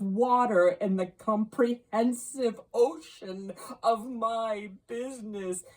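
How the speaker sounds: a tormented spirit crying out its regret; anguished ghost declaiming to Scrooge; lamenting ghost. A narrator's reading.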